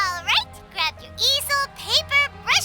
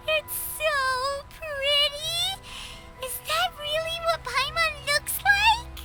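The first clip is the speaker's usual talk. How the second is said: soft, slow, drawn-out wonder; hushed, smitten admiration; awed delight; 2 semitones higher, 4 dB quieter, slower than usual. Acted. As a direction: melt at the lovely portrait. Awed by the flattering portrait.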